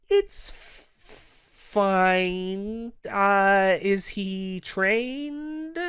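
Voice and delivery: lightly voiced barmaid, jumping high on it's; a hint of a startled tavern girl, slow on the last question; mildly thrown barmaid, drawn-out is he trained; nervous barmaid. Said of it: "looks at the big owl and says".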